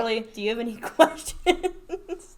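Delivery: slow question breaking into laughter; laughing question. Real, not acted. Host asking co-host for questions, laughing. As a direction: ask it slowly through a giggle.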